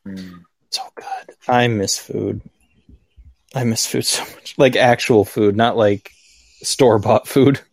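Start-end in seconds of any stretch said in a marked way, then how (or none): 0.1-4.5 whispering. Real, not acted. Craving food, dropping to hushed voice.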